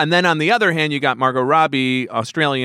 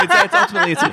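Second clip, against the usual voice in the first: laughing; 3 dB louder, much faster than usual. Real, not acted. Joking anti-France vote theory.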